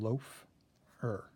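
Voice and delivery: loafer drawn out very slowly; slowly stretched. Shown as a demonstration. Teacher stretching the word so kids hear the long O. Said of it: "long O".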